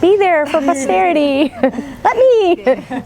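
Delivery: high mock voice of an eager mother; imitating mother. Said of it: "She's like".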